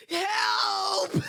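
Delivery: high, strained comic shout; hammed-up, high-pitched yell for help; struggle cry for help. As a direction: cry out as if pinned in a wrestling match. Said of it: "I made a struggle sound".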